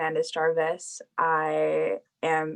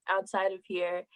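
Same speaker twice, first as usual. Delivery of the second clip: ordinary talk, nothing marked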